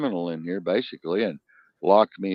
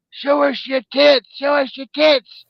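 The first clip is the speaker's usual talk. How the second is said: high, slow, loud holler; hollered across the lake; 8 dB louder, slower than usual. Retold or imitated. Slow brother hollering across the lake at the girls, high slow shout. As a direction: call it out across the water like a goofy country boy. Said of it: "the brother hollered over there".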